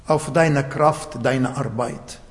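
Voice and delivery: ordinary talk, nothing marked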